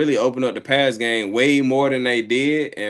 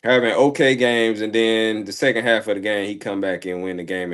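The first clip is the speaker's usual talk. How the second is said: ordinary delivery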